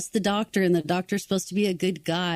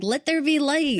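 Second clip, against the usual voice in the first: grand, raised voice of God; booming divine voice; 7 semitones higher than usual. Retold or imitated. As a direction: announce it like a creator. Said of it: "It's like".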